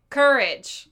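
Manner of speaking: loud sarcastic drawn-out courage; mock chorus sarcasm. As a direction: say it with a mocking laugh. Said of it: "they all very sarcastically say".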